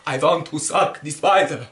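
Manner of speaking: slow, creepy mock vampire; hammed-up bloodsucker demanding his due. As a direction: draw it out like a hammy vampire. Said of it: "the new vampire. He was so creepy".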